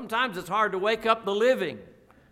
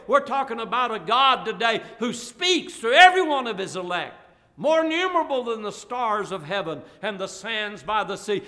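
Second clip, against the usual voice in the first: louder, driving to "live"; fervent preaching; 2 semitones higher, 5 dB louder than usual. Acted. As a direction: drive home the point. Preacher rising again.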